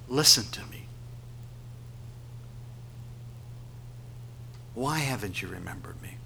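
hushed, hurt whisper; soft whispered reproach; whispering in the ear. Acted. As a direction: whisper it as an intimate plea. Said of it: "as if the Lord is whispering in our ear".

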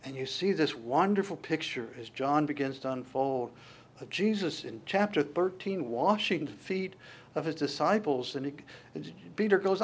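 usual speaking voice

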